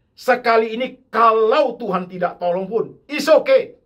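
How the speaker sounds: full-force preaching. Real, not acted. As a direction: proclaim God's help to the church. (khotbah berapi-api, lebih tinggi dan keras)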